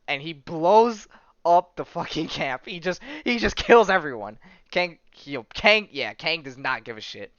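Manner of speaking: excited outburst. Real, not acted. Excited retelling of camp massacre.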